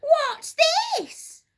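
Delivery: high, loud startled gasp. A narrator's reading. Missus Twit entering the room, high startled gasp. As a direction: gasp in shock as if struck. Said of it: "gasped Missus Twit".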